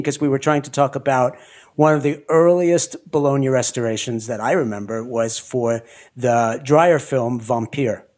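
ordinary talk, nothing marked

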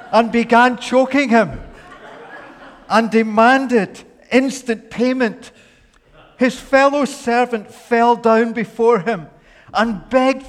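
high, loud dramatic preaching; storytelling at full force. Acted. Preacher acting out the parable, in a loud high telling. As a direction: tell it big and breathless, building.

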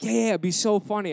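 loud, high, excited urging; eager egging-on. Retold or imitated. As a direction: hype it up to talk someone into it. Friends egging him on.

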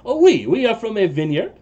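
put-on light French-accented halfling; a halfling answering Jean-Claude in a light French accent. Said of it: "like your accent".

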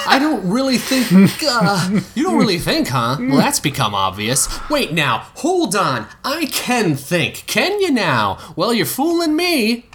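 high, slow, dopey mock voice; a teasing girl mimicking a slow boy; mocking imitation. A narrator's reading. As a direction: parody him as a dimwit. Delphi mocking Cedric's reply.

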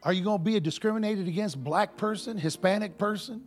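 rising preacher's call, pressing each question; preaching. Acted. Preacher challenging his congregation, in full preaching voice. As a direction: preach it to the flock, pushing hard.

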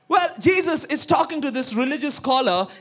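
ordinary delivery

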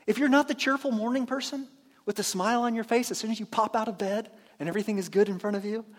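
ordinary talk, nothing marked

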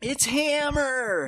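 high, drawn-out holler; mock excited shout. Quoting the neighborhood watch in Simpsons, high drawn-out shout. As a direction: yell it out long and high like a cartoon crowd.

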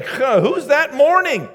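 high, drawn-out shouted question; loud startled question. Acted. Jesus arriving amid noisy mourners, loud startled question. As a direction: call out over the wailing, pitched high. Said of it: "Jesus comes in and".

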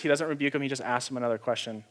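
ordinary delivery

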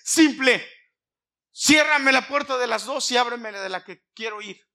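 very loud, high, impassioned preaching; fervent preaching. Acted. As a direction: shout the prayer out to the church. Preaching at full force.

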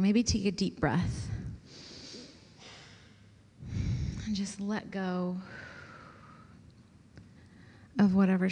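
soft, unhurried voice easing them into stillness; guided meditation. Acted. Pastor guiding the group into quiet prayer, soft meditation voice. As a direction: let each phrase settle before the next.

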